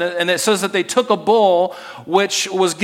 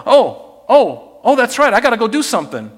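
loud, startled realizing god; hammed-up sudden remembering; Baal suddenly remembering his worshippers, loud and hammed up; mock Baal voice; 5 semitones higher, 6 dB louder than usual. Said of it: "Baal will go".

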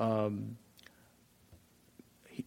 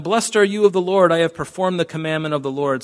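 bright, eager, higher and louder; eager boast; 5 semitones higher, 7 dB louder than usual. Acted. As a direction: Saul puffing himself up to Samuel. Saul greeting Samuel, boasting.

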